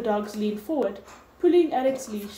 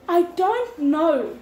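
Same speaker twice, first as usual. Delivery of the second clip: slow, higher and louder, voice catching on tears; choked voice; 7 semitones higher, 6 dB louder, slower than usual. A narrator's reading. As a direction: force each word out through a tight throat. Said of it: "her voice choked".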